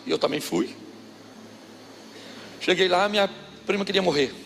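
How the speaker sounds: normal talking voice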